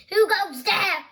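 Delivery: a hungry troll calls out from under the bridge; grumbling troll. Acted. Troll challenges the goat on his bridge, loud. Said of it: "grumbling the hungry troll".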